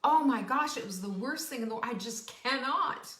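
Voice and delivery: mock dramatic. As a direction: overplay the drama queen. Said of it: "how dramatic and how emphatically we talk".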